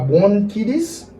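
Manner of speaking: mis-say BRONCHITIS with KEE for KY, rising; wrong pronunciation. Shown as a demonstration. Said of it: "E pa".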